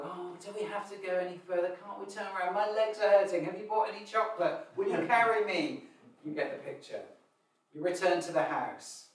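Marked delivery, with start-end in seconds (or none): 0.0-5.9 put-on whiny child. Child pestering parents mid-walk, plaintive and whiny. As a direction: nag like a tired child on a walk. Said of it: "And you go on the walk".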